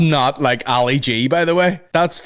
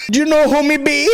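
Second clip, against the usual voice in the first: high-pitched mock Jamaican patois; hammed-up Jamaican accent, way up high; squeaky party boast in Jamaican patois; 4 dB louder than usual. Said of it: "He's at parties like".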